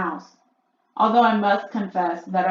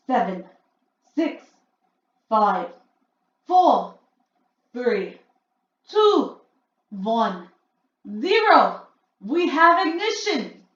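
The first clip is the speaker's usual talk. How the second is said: gleeful countdown; 4 semitones higher, 7 dB louder, slower than usual. A narrator's reading. Witch counting down to the spell.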